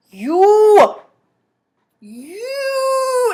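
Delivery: loud, pointed You!; angry accusing shout. Retold or imitated. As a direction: bark it at your sister. Brother angry at her.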